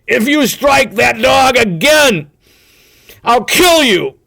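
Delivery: high, loud, slow threat through clenched fury; barely able to get the words out for anger; choking with rage. A narrator's reading. Thornton threatening Hal over beaten dog, choking furious shout. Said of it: "in a choking voice".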